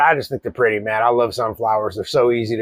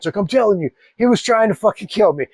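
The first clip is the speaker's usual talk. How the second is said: higher, breathless retelling of the attack; wife's dramatic claim; 8 semitones higher than usual. Retold or imitated. Wife telling husband the rooster tried to kill her, dramatic. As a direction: sell it as a near-death story.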